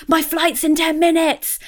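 high, panicky travellers' wail; panicked high cry. Retold or imitated. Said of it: "Everyone was like".

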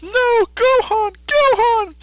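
very high, slow cartoon cry; Goku crying out. Goku calling after Gohan, high and slow. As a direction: stretch out the name in despair. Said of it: "He says".

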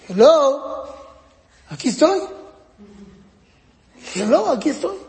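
sing-song bid for attention; child seeking attention. Retold or imitated. Four-year-old calling to parents, wanting attention, lightly childlike. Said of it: "Entonces, ¿qué hace?".

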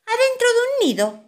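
put-on very high, loud child voice; excited children. A narrator's reading. Children telling mamá where they have been, excited. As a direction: chirp it out as children. Children answer mother gleefully.

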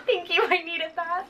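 laughing thanks. Pretend. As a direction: say it through a laugh. Joking thanks to video.

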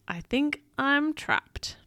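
understated storybook worry; worried gulp. A narrator's reading. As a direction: act a nervous realisation, slowly. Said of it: "Peso gulped".

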